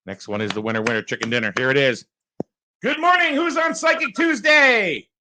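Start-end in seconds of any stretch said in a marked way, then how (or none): 1.6-5.1 excited host greeting. Real, not acted. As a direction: shout a high welcome to the winning caller. Host greets the winning caller.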